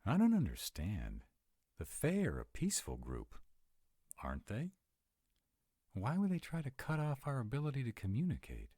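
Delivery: rising disbelief that peaceful Fae would attack; upset bewilderment. Acted. Confused about the Fae attacking.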